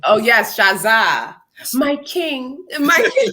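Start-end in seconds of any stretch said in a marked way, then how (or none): 1.7-3.3 high adoring my king; mock reverent. Hailing the character Shaza to friends, mock adoring. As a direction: greet him like royalty, hammed up. Hails Shaza Zulu playfully.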